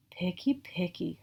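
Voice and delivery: softly whispered grumble; whispered complaint. Acted. Herbert grumbles at the picky child, whispering. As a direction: complain under your breath like a put-out monster. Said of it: "Herbert complained".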